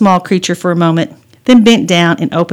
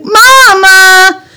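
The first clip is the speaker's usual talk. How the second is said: high, loud drawn-out wail for mom; tattling yell; 13 semitones higher, 9 dB louder than usual. Acted. Bopped sister calling her mother, tattling yell. Child calls mother to complain.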